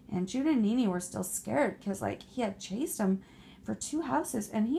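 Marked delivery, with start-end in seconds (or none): none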